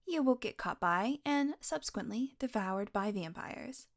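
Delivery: usual speaking voice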